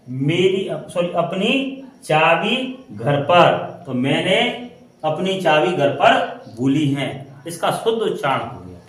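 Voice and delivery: ordinary delivery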